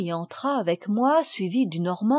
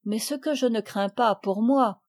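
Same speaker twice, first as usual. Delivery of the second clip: plain reading voice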